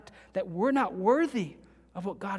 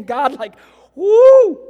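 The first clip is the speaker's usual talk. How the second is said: sudden high, loud whoop of awe; overwhelmed worshipper whooping at God; ecstatic shout; 8 semitones higher, 20 dB louder, much slower than usual. Pretend. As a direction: let out a wild cry of wonder. Imagined reaction in glory.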